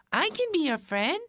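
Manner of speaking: high little ant voice; friendly tiny ant. Acted. Ant offers Rabbit friendship, tiny friendly voice.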